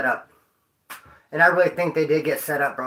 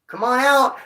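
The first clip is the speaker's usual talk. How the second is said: higher, louder cop calling out; imitating cop; 6 semitones higher, 3 dB louder, slower than usual. Mocking the cop who should have warned him, calling out. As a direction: call him out from under the trailer.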